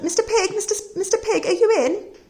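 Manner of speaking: high, sing-song call through the door; polite wolf calling at the door. Acted. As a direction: call out sweetly to the pig.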